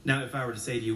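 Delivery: normal talking voice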